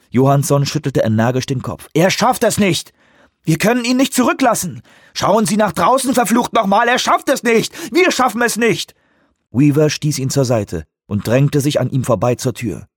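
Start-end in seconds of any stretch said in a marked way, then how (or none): 1.9-8.9 hohe, drängende Stimme voller Angst; verzweifelter Streit. Acted. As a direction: schrei deine Angst dagegen an. Johansson streitet verzweifelt im Cockpit. (verzweifelt und drängend)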